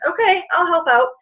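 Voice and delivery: a hint of a chirpy little helper; cheerful body fat. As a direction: play the helpful fat volunteering. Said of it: "The fat says".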